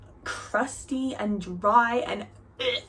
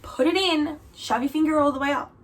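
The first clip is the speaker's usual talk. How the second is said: higher-pitched urging, imitating mum; insistent mum; 7 semitones higher, 3 dB louder than usual. Mum instructs daughter through the door, insistent. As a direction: coach it like an impatient mum, pitched up. Mum's urging voiced in story.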